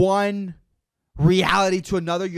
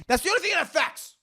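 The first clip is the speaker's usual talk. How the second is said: fast, high-pitched squawk; shrieky, rushed insistence; exasperated outburst; 13 semitones higher, faster than usual. Real, not acted. High outburst capping his argument.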